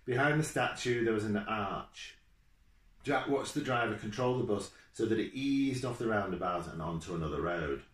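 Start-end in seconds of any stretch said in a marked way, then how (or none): none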